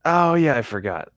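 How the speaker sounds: ordinary talk, nothing marked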